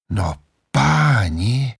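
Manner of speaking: slow, drawn-out wonder; amazed exclamation. Acted. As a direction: marvel at the view. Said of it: "zvolal Petr". (Petr užasle zvolá)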